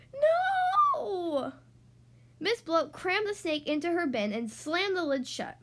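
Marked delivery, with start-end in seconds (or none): 0.1-1.5 horrified cry. A narrator's reading.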